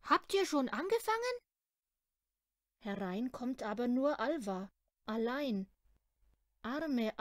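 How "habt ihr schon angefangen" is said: high, bright call as she rushes in; eager girl calling in. Retold or imitated. Girl bursts through the door asking if baking started. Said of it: "hereingestürzt kommt und ruft".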